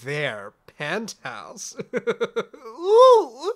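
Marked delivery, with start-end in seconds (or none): 1.9-3.5 high, loud delighted laugh and ooh; giddy ooh. Acted. Puck thrilled as Obi arrives, giddy laughing ooh. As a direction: squeal with glee.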